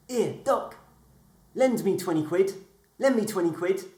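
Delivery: high, pushy little voice begging; cheeky talking leg. Acted. Talking thigh begs the doctor for cash, high and cheeky. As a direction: wheedle for the money.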